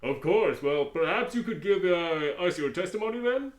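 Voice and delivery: almost whispered prosecutor; hushed. Acted. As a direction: ask it under your breath. Quiet aside voice.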